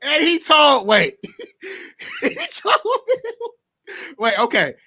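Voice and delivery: breaking into laughter mid-sentence; can't get the words out for laughing; real giggles. Real, not acted. Cracking up while retelling.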